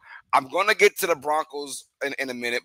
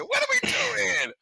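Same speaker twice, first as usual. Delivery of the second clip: much higher, slow, stretched in disbelief; incredulous squeal; 4 dB quieter than usual. Real, not acted. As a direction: squeal it out, completely baffled. Ranting about the Cowboys' trades.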